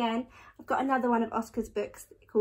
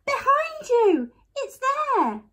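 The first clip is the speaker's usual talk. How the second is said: high, loud panicked warning; screamed warning; 13 semitones higher, 6 dB louder than usual. A narrator's reading. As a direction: scream it out in fright. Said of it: "screamed Eddie".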